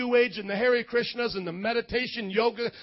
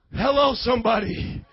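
emphatic commands with stretched endings; preaching at full force; 3 semitones higher, 5 dB louder than usual. Real, not acted. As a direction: hammer out each rule to the congregation.

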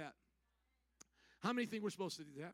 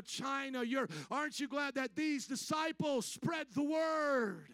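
building preaching; 4 dB louder than usual. Real, not acted. Preacher rallying a grateful congregation, building preaching. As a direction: drive each question harder, up to a loud peak.

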